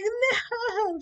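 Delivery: loud, high mock-bawled no; wailing refusal. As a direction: draw the no out into sobs. Bawling refusal in retold breakdown.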